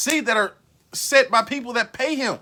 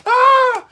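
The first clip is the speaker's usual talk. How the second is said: high laugh; 7 dB louder than usual. Real, not acted. Big laugh at the clip.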